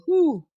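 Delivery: high "whew" dropping off; exclaimed whew. Real, not acted. Exclaims after imagining a humiliating loss.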